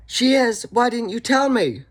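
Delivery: high, loud, startled squeal; mock surprised Elrond. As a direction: shriek it like a flustered Elrond caught off guard. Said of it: "And then he gets up".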